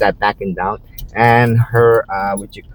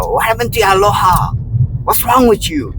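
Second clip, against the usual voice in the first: high mock shout; raised voice; 10 semitones higher than usual. Me raising my voice at the couple, high mock shout. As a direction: raise your voice at them. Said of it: "kinda raising my voice saying".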